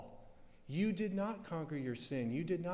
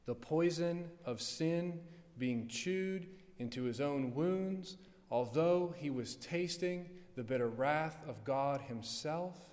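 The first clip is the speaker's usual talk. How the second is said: ordinary talk, nothing marked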